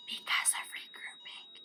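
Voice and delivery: hushed, voiceless whisper; very soft whispered prompt; whispered answer. Shown as a demonstration.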